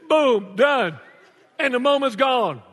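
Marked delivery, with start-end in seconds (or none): 0.1-1.0 exclaimed. Acted. Punchline exclaimed.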